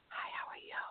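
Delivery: put-on breathy, barely-there whisper of a teen; imitating a whisper. Voiceless teen boy greets the healer, whispered. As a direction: whisper it the way the shy boy always spoke. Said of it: "this is how he would talk".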